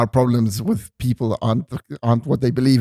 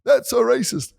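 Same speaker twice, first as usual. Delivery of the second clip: offended crowd member; 12 semitones higher than usual. Retold or imitated. Comedian's story of an offended crowd member, in a higher mock-outraged voice. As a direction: play an outraged heckler. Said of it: "They'll be like".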